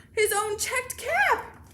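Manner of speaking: loud, high and drawn out; dismayed discovery. A narrator's reading. Peddler feels only his own cap, dismayed. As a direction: land each word with astonishment.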